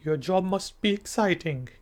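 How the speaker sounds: slow, clear spoken example; slow model reply. Shown as a demonstration. Teacher models how the student should answer. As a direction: say it slowly so students can copy it. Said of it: "तो आगे से आप क्या कहेंगे".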